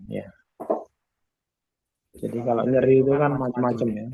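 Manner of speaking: ordinary talk, nothing marked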